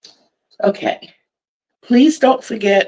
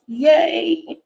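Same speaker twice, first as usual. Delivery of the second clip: happy cheer; 9 semitones higher than usual. Real, not acted. Cheers at twenty-three washcloths.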